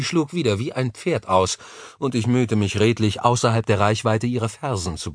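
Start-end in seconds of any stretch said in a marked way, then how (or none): none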